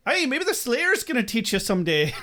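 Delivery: hammed-up fake Irish lilt; mock bad Irish accent. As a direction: poke fun with a phony Irish accent. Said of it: "making fun of Angel's shitty accent".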